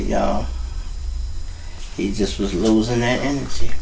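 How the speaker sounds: plain reading voice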